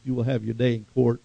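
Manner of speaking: ordinary talk, nothing marked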